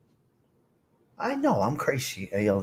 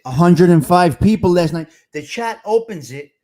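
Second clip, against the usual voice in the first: usual speaking voice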